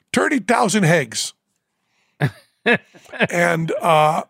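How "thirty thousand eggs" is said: put-on Newfoundland accent. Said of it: "Jerry has the Newfoundland accent".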